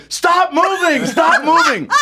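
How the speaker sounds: high-pitched imitated handler's yell; hammed-up, high urgent order; mock frantic yell. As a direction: call out in mock panic to a fleeing kid. Handler yelling at fleeing kid, retold.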